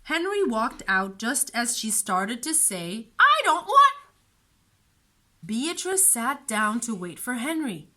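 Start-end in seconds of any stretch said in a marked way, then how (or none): 3.2-4.0 put-on high, loud whiny child voice; a stubborn little sister refusing; whiny little girl. A narrator's reading. Beatrice voiced, cut off.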